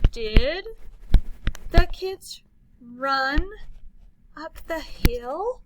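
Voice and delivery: loud start, slow rise; loud question. Shown as a demonstration. Teacher turning an exclamation into a question, asking. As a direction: ask it wondering. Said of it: "It becomes a question".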